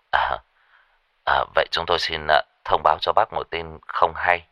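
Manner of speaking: low, hesitant, measured caller; deep, warm man. Acted. Caller prepares the father for bad news, deep and careful. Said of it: "giọng nói của một người đàn ông trầm ấm".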